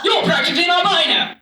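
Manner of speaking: put-on high, quick old-timey exclamation; Charlotte. Mom's friend insults the daughter's pallor, old-timey dismay. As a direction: pronounce a verdict in appalled old-timey accent. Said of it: "she turned to me and said".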